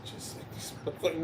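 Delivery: whispered under the breath; whispered mock. Mother muttering about son's friends, whispered. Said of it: "She's like".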